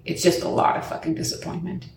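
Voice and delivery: hushed, voiceless letdown; whispered disappointment. Real, not acted. Stranded by her locked car, real whispered disappointment. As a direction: breathe the words out without voice.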